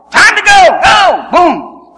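loud, high burst; race-start shout. Acted. Runner acting out the start of the race, excited shout. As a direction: call it out like a starting gun.

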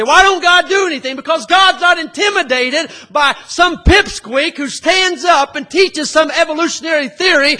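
loud, fired-up pulpit preaching with shouts; fiery preaching. Acted. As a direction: thunder it at the congregation. Preaching at full force.

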